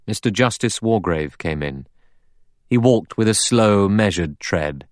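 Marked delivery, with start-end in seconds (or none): none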